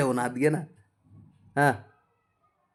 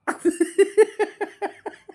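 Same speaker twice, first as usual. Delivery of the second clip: high giggling burst; high laugh. Real, not acted. Parent laughing at baby. (उंच खिदळणे)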